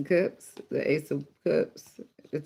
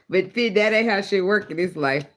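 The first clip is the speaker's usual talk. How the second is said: higher voice, still laughing; talking through laughter; 5 semitones higher, 4 dB louder than usual. Real, not acted. Reader lecturing a coward while still cracking up, laughing. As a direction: push the words out through the laugh. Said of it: "I shouldn't laugh".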